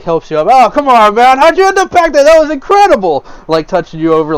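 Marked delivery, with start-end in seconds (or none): 0.5-3.2 much higher, louder delighted exclaim; amazed helpful patron. Acted. Patron helps the stranger up, high excited praise. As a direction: gush about it like you just saw a stunt.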